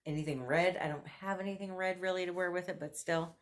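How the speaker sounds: ordinary talk, nothing marked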